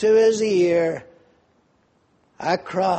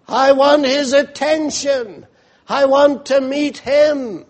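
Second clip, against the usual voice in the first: loud, high, urgent cry; a blind beggar shouting for the Savior; crying out to Jesus; 5 semitones higher, 4 dB louder than usual. Retold or imitated. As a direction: call out with everything you have. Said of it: "But he's not interested".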